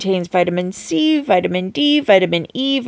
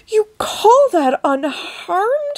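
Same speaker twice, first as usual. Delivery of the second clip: snapped; 11 semitones higher, slower than usual. A narrator's reading. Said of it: "snapped Missus T V".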